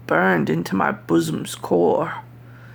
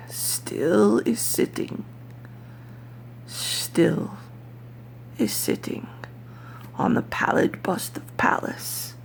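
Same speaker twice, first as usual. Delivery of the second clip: whisper; 3 semitones higher, 5 dB quieter than usual. Acted. Narrator fading out over the raven still sitting, whispering. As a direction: let the ending sink into a whisper. Ending fades into dread.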